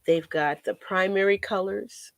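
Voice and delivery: ordinary talk, nothing marked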